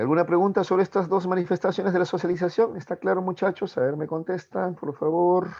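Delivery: coaxing. Real, not acted. Teacher pressing silent class for answers.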